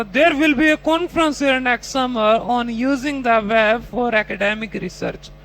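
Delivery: slow, careful reading of the long test sentence; slow model reading. Shown as a demonstration. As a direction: read the prompt slowly and clearly. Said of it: "अगला question आता".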